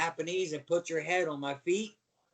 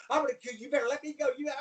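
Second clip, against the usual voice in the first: angry threats; 6 semitones higher, much faster than usual. Retold or imitated. Acts out trapped opponent's threats.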